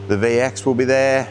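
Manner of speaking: ordinary talk, nothing marked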